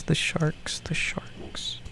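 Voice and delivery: fading into an eerie whisper; spooky whisper trailing off. Acted.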